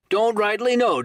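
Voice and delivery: high, loud scornful parody; mocking echo. Silver mocks Morgan's answer, high and loud. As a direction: throw his words back at him.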